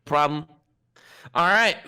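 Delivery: ordinary talk, nothing marked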